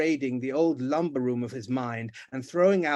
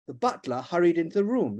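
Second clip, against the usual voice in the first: ordinary delivery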